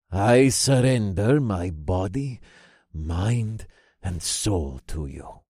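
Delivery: slow, solemn oath of surrender, falling away; reverent cult follower. A narrator's reading. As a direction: pledge it slowly and humbly, sinking at the end. Cult leader kneels and swears loyalty.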